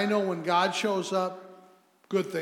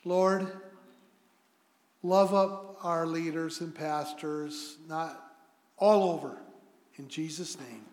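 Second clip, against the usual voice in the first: spoken prayer; 4 semitones lower than usual. Real, not acted. Leads a prayer.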